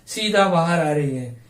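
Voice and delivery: ordinary delivery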